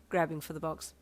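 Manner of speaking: ordinary delivery, nothing marked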